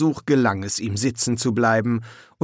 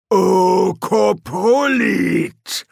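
troll curse; 8 semitones higher, 6 dB louder, much slower than usual. A narrator's reading. Troll swears.

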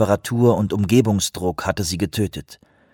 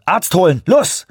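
commanding shout; 8 semitones higher, 4 dB louder than usual. Acted. Officer commanding crew.

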